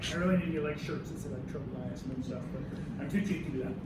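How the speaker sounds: low, soft throwaway mumble-aside; offhand aside. Real, not acted. Teacher joking aside about tropomyosin shirts, low offhand aside.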